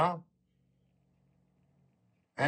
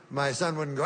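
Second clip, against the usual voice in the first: ordinary talk, nothing marked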